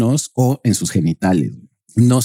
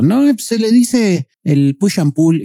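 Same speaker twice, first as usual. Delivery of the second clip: imitating the famous coach; 7 semitones higher, slower than usual. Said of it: "un coach muy famoso y que dijo".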